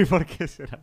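slow, laughing through the words. Acted. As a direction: chuckle the question out. Repeats the joke line laughing.

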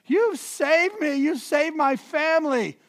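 high, slow, gushing thanks; mock-tearful gratitude, pitched high and drawn out; gushing grateful man. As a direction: ham up the grateful dad's relief. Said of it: "It's just like".

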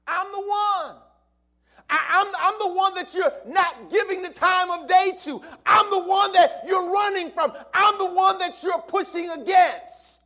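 voice of Jesus. Acted. Jesus confronting Saul who runs from him, loud preaching shout. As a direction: cry it out as Jesus pleading. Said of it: "He says".

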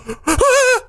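high mock crying gasps; hysterical sobbing gasps. Son imitates his mom bawling on the flight, mock hysterical gasps. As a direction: imitate mom's hysterical, gasping sobs. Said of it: "like, hysterical".

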